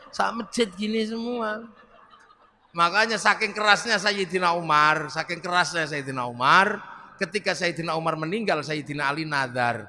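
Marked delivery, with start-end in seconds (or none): none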